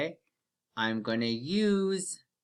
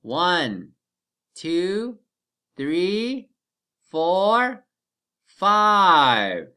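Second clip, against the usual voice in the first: rising, louder count; counting aloud, building louder; 3 semitones higher, 7 dB louder, much slower than usual. Shown as a demonstration. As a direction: count up with growing energy. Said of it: "Let's count together".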